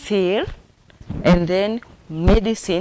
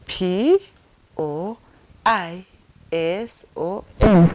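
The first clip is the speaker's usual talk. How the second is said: slow, spaced-out letters; spelled out; 5 dB louder, much slower than usual. Shown as a demonstration. Teacher finger-spelling poison for learners, spelled out. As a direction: spell it one letter at a time. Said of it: "repeat it again so that you can understand better".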